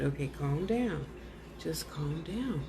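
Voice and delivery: quiet, slow hush to calm a spirit. Real, not acted. Medium soothing the agitated ghost Robert, soft and slow.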